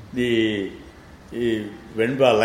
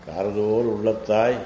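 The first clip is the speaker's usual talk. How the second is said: rapid verse recitation; much faster than usual. Shown as a demonstration. Teacher reciting the verse to his student, fast and chanted. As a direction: rattle off the Tamil line in quick chant.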